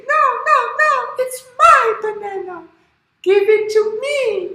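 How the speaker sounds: very high, loud squeal; protesting animals. Acted. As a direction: squeal back like a chorus of small animals. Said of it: "the bear and the monkeys".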